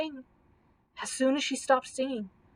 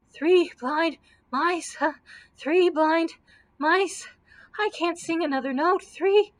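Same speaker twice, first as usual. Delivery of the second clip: high, slow breathless singing; gasping, out of breath; 5 semitones higher, 4 dB louder than usual. A narrator's reading. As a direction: sing with no breath left from running. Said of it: "gasped poor Dorothy".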